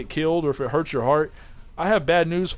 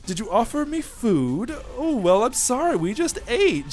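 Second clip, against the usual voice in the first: Whis; 9 semitones higher than usual. Acted. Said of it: "Whis is Whis. He's like".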